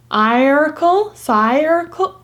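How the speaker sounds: siricle with long I as in eye, slow; wrong long I reading. Shown as a demonstration. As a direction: sound circle out wrongly, slowly. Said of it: "read it like the I saying its own name".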